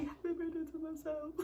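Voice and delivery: high, wry "Happy birthday to myself"; sarcastic self wish. Retold or imitated. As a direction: toast yourself bitterly.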